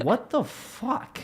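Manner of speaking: slow, high disbelief; mock baffled. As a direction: stretch it out, baffled by the open door. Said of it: "gives him this look".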